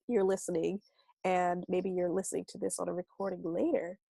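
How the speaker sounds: ordinary talk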